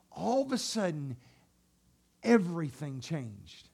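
soft, low, hushed build-up; whispered suspense; hushed storytelling. Acted. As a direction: draw them in quietly. Preacher drops to hush.

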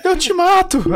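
high mock threat from a kid; angry daughter. Imitating his daughter threatening dad after the ride. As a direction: threaten like a cross little girl. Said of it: "E aí, Ana?".

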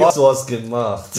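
put-on slow, low, broken German with a Russian accent. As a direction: scold like a Russian dentist. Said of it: "Natürlich hat er einen russischen Akzent".

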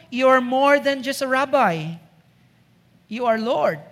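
usual speaking voice

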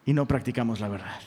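ordinary delivery

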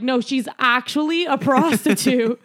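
loud laughing "no, she's actually"; laughing insistence. Retold or imitated. Laughing as she insists to her friend.

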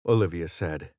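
ordinary delivery, nothing marked

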